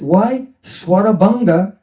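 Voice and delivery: ordinary delivery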